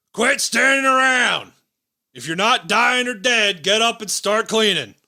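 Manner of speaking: a sergeant bellowing across the trench to idle soldiers; drill sergeant shout. Acted. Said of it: "you hear a voice that goes".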